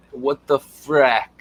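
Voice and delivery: slow, drawn-out what the frack; slow emphatic. Real, not acted. Ranting to viewers, drawling what the frack slowly. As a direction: drag out what the frack in disbelief.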